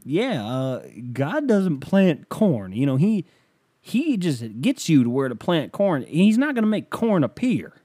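slow, emphatic, swooping pitch; folksy host analogy. Retold or imitated. Host lecturing caller on faith, hammed-up preachy. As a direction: spell out a simple analogy with mock wisdom. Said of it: "he would say some fucking stupid analogy".

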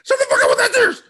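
high, loud mock rage; hammed-up screaming fit; mock furious yell. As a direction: shriek it like a man losing it. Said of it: "He gets madder".

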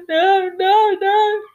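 hammed-up high, loud wailing sob; mock ugly crying. Reviewer mocking her own full-on ugly crying. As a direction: overplay a blubbering cry for laughs. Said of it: "I'm not gonna sit there and go".

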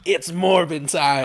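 big hammed-up catchphrase shout; meme shout. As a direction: belt out the meme line. Catchphrase shouted after announcement.

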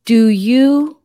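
loud, very slow word-by-word question; drawn-out, booming mock interrogation; in-your-face demand. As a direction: imitate someone talking down to a foreigner, loudly. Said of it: "came up to me in my face and said".

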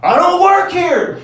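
high, loud, slow mock yell while running off. Fleeing homeless man yells at comedian, hammed-up high yell. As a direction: yell it back over your shoulder, hammed-up. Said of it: "but he's yelling".

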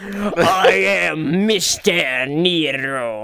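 slow grand hero declaration; anime hero. Pretend. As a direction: announce the name like an anime hero. Slow dramatic self-introduction.

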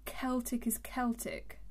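"Celtic" said slowly as KEL-tik both times; hard C. Shown as a demonstration. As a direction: start each "Celtic" with a K sound. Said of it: "Why are they pronounced differently".

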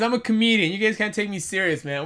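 ordinary talk, nothing marked

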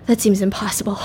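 whispered, breathy doubt; mock whisper. Nervous accomplice answering the plan, whispered.

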